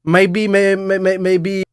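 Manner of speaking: stammering mock Indian English; Indian accent. Shown as a demonstration. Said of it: "avec un accent indien". (accent indien imité, en bégayant)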